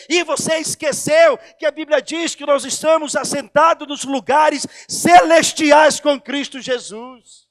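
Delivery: loud, rising sermon proclamation; full-force preaching. Real, not acted. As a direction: shout the promise to the congregation. (pregação em voz alta e inflamada)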